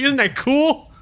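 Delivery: high, eager toy robot voice; gruff tinny action figure. Retold or imitated. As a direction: play the toy bragging in a squeak. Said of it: "He's like".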